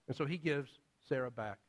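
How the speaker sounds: ordinary talk, nothing marked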